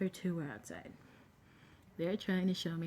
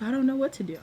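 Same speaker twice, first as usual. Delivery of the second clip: higher, louder, frantic rush; panicked outburst; 7 semitones higher, 9 dB louder, faster than usual. Retold or imitated. Reliving drowning for viewers, frantic louder outburst. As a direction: blurt it out in sudden panic.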